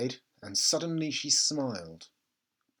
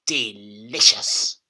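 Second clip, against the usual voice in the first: slow, drawn-out savouring; grandma savouring slugs; relishing; 3 semitones lower, 8 dB louder, much slower than usual. A narrator's reading. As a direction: stretch it out, tasting it. Savouring, lips pursed.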